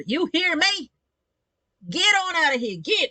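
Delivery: high, hammed-up Southern holler; Southern accent. Southern mum chasing boys away, Southern accent. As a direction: shoo them off in broad mock Southern. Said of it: "I became Southern just then".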